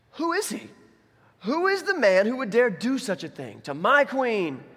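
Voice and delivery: high, loud, slow royal demand; indignant king's outburst; outraged king. Acted. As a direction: thunder it in acted indignation, stretching the words. Acts king's outraged demand to Esther.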